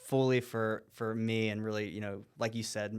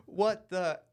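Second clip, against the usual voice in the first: mock freak-out; 6 semitones higher, 4 dB louder than usual. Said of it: "this guy just starts flipping out".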